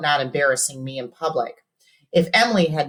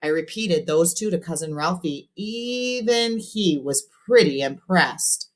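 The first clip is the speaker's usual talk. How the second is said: usual speaking voice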